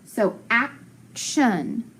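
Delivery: "action" drawn out slowly, AK-shun; teacher blending the whole word for the class; slowly blended. Shown as a demonstration. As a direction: stretch "action" out as you blend it. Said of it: "sounds like S H".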